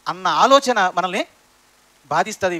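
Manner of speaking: normal talking voice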